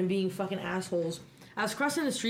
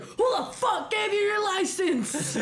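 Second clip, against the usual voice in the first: mock angry yell; 11 semitones higher, 3 dB louder than usual. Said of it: "I was like".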